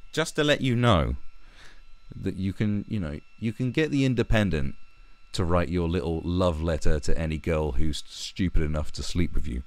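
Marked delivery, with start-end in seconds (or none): none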